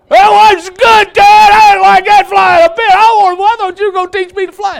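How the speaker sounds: very high, shouted squeaky chick voice; shaken eaglet. Acted. Eaglet shouting up at dad after nearly crashing, high squeal. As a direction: squeal it out like a rattled little bird.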